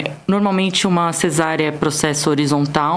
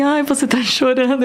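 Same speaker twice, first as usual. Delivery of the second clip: higher-pitched, concerned question; lightly voiced caring nurse; 7 semitones higher than usual. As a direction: imitate a nurse fussing over a patient. Nurse's reaction acted out in retelling.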